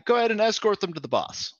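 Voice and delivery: high, quick brisk command; lightly voiced guard barking orders; guard's order. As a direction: rattle it off like a busy gatekeeper. Guard orders escort to boss.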